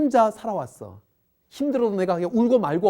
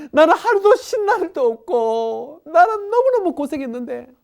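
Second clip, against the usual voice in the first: whiny self-pity; 7 semitones higher, 5 dB louder than usual. Acted. Man's self-pity, acted.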